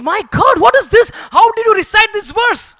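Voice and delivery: high, loud cry of disbelief; stunned exclamation. Acted. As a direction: gasp it out, utterly amazed. Keshav stunned by the recitation.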